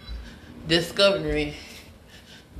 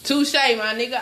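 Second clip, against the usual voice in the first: usual speaking voice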